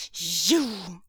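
sputtering outrage; indignant burst; outraged. Acted. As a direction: spit the word out.

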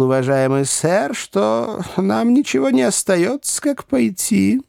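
high, deliberate, anxious counsel; prim little gentleman urging compromise; fussy little lawyer. A narrator's reading.

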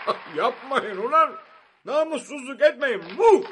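high, loud, laughing plea; bewildered laughing. A narrator's reading. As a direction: laugh helplessly while begging them to stop. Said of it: "şaşırıp gülerek".

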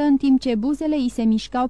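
ordinary delivery

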